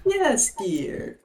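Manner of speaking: granny voice. Acted. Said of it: "[name] can be the Nana".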